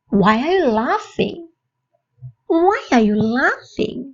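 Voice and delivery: slow, evenly spaced teaching delivery; slow model phrase. Shown as a demonstration. Teacher to English learners, slow model phrase. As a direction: say it slowly for learners to copy.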